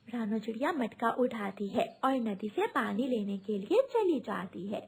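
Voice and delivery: ordinary delivery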